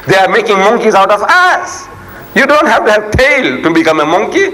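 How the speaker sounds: high, insistent sermon delivery; impassioned preaching. Real, not acted. Preacher rebuking those who hear scripture as entertainment, impassioned preaching. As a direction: press the rebuke on the congregation. Preaches indignantly at full force.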